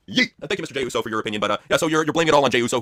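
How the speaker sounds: ordinary talk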